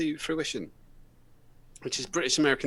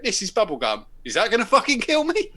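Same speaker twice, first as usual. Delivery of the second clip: loud, much higher outraged customer; exasperated customer; 8 semitones higher, 7 dB louder than usual. Retold or imitated. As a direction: build to a loud high outburst. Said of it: "And he went".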